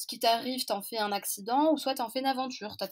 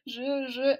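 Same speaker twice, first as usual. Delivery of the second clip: choked, higher sobbing stammer; crying; 4 semitones higher, 4 dB louder than usual. Retold or imitated. Granddaughter crying to her grandmother, choked and sobbing. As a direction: try to speak through tears and fail. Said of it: "En pleurant".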